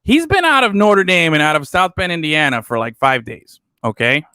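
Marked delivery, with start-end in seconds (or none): none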